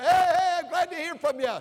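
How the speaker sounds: a beaming father's greeting; retold eager dad picking up the phone; fired-up dad. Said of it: "he was so fired up".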